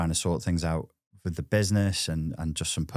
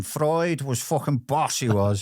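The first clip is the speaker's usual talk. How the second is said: hammed-up Scouse; 6 semitones higher, slower than usual. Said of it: "it's the accent, though, innit?".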